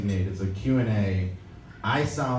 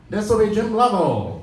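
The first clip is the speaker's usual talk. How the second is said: much higher and louder sharp broadcaster voice; Jim Lovell impression; 10 semitones higher, 5 dB louder than usual. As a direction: announce yourself like a confident old astronaut. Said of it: "I'll do my voice".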